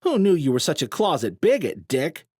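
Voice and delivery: mocking jab. A narrator's reading. Jarek mocking Sloan.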